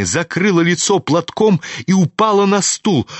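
plain reading voice

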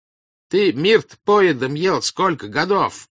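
loud, high, drawn-out accusation; angry shout. A narrator's reading. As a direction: shout him down, slow and high. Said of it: "кричал на него Карп".

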